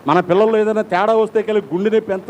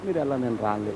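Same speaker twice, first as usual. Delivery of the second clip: heartbroken refusal; 8 semitones lower, 9 dB quieter, faster than usual. Retold or imitated. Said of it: "హృదయం కేకలేస్తే ఎక్కడ కనబడుతుంది".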